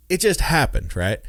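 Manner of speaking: usual speaking voice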